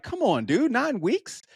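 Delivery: high rising disbelief on nine weeks; incredulous. Real, not acted. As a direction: question it like it's absurd. Incredulous at runner.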